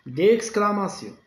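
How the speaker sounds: teacher stressing d'exclamation; stress on exclamation. Shown as a demonstration. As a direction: lift d'exclamation for emphasis. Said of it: "il se termine par un point".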